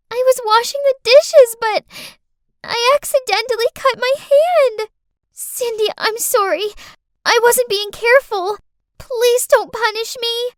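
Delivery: put-on high, loud, tearful little-girl plea; crying child. Girl with a cut hand begs not to be punished, crying. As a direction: wail it like a hurt kid begging. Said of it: "saw Clarice crying as she".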